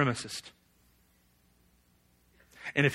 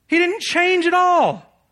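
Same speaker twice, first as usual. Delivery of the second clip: much higher, slow, full shout; 9 dB louder, slower than usual. Real, not acted. Preacher repeats his point to the congregation, shouting. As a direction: cry it out slow and loud. Preacher hammers Jonah's failure.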